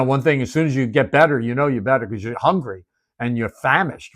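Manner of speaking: ordinary talk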